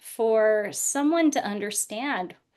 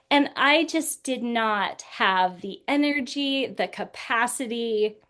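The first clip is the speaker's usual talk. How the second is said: ordinary talk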